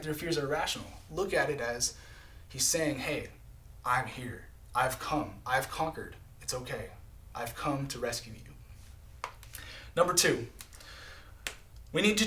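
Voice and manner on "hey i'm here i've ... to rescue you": tender reassurance. Acted. Jesus comforting the fearful listener.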